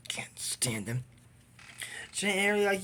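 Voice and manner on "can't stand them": unvoiced muttered aside; breathy whispered grumble. Real, not acted. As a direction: whisper your dislike. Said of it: "whispered aside".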